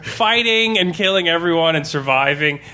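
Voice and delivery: ordinary talk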